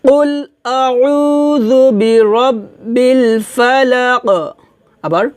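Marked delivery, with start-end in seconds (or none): 0.1-4.3 recitação lenta e alongada, eco final em "Falaq"; recitação em conjunto. Shown as a demonstration. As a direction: puxe o coro devagar pelo verso. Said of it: "একসাথে বলি".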